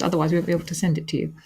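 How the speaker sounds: ordinary talk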